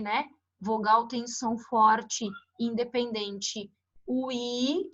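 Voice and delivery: ordinary talk, nothing marked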